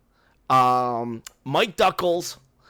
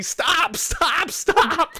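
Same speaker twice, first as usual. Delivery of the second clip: urgent stop; 13 semitones higher, much slower than usual. Retold or imitated. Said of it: "Brenda's, like, hitting me".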